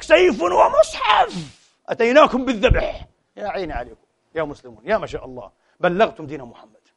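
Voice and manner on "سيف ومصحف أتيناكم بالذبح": high, slow sneering chant; mocking slogan. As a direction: parody the zealot's battle cry. (شعار متعصب بسخرية وببطء)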